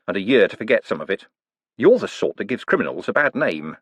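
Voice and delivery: ordinary delivery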